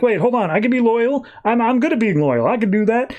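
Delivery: high, frightened robot begging for mercy; mock panicked pleading. Robot begging the Machine Queen not to disassemble him, high panicked plea. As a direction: plead like a terrified little robot.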